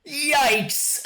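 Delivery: high, drawn-out yikes; sharp high reaction to awkwardness; cringing alarm. Real, not acted. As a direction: yelp it in comic discomfort. Reacting to an uncomfortable scene.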